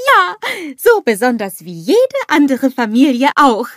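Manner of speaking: loud, high cheering; jubilant. A narrator's reading. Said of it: "jubelt der kleine Schneemann".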